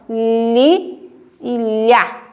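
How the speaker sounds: LI narrow, then LHÁ open; contrasted syllables. Shown as a demonstration. Teacher contrasting the letter sounds, slow careful demonstration. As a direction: slowly set LI apart from LHÁ. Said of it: "têm som".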